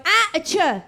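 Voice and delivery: exasperated mock-Italian toddler; angry Italian accent. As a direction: imitate a cross little Italian man. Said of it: "like a little Italian man who's angry".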